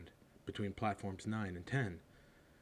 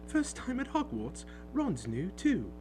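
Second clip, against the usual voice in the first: much higher, bright mum; chatty motherly voice; 11 semitones higher, 3 dB louder than usual. Acted. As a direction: chat kindly to a nervous boy. Mother chats to Harry.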